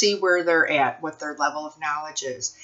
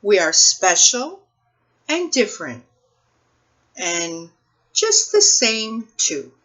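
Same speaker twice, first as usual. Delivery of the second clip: lively, sing-song picture book read-aloud, slower and higher; reading to children; 5 semitones higher, 7 dB louder, slower than usual. Acted. Librarian reading a picture book to children, expressive storytime voice. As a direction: read it like storytime for little kids, savoring each rhyme.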